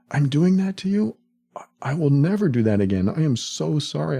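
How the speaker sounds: a loving partner, shocked to learn they hurt you; stunned apology. Acted. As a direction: gasp it out as if you just found out. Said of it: "finally have some sort of empathy".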